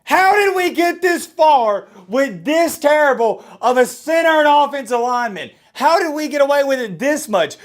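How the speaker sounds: furious high shouting rant, slowed; rage monster. Real, not acted. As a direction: blow up at the center in full fury. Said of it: "I turned into a rage monster".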